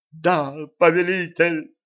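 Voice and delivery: high, slow ecstatic cry; rapturous. A narrator's reading. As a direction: answer in pure rapture. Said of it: "восторженно откликнулся бледный". (восторженно)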